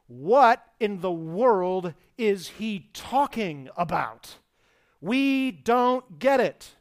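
loud, slow, baffled outcry; confused disbelief. Retold or imitated. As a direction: throw up your hands in confusion. Disciples' confused question acted out.

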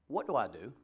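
usual speaking voice

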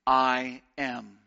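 slow, dropping, reverent quote read; slow solemn reading of a commentator's line. Acted. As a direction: let each word fall heavy and slow. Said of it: "one commentator, Edward Klink, says".

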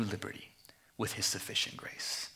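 soft, low whispered assurance; hushed reassuring whisper to the congregation. Real, not acted. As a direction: lean in and whisper it to the church. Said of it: "whispers the blessing to the church".

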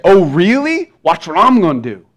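hammed-up offended retort; mock angry God. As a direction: throw it out as a mock threat. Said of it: "he might get mad at me".

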